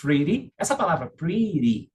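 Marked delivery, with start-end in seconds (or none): 0.0-0.5 "Pretty" pronounced "priri". Shown as a demonstration. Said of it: "não se escreve com R". 1.1-1.9 "pretty" with an American flapped T. Shown as a demonstration. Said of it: "não se escreve com R".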